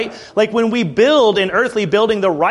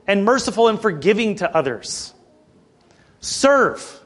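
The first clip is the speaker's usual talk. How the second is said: ordinary delivery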